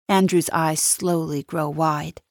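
ordinary talk, nothing marked

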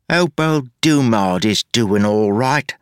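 slow, mocking knock-off street accent; a smug copy of his voice; parodied accent. A narrator's reading. The double taunts Wayne about Dumard, mocking accent.

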